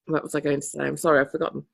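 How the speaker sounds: usual speaking voice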